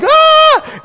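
high, loud mock scream; startled scream. Preacher acts out the driver's shock, startled scream.

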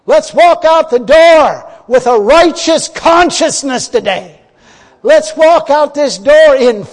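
loud, slow, high preaching; full-force preaching. Acted. Preacher urging the church at communion, loud and slow. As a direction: preach it out to the congregation.

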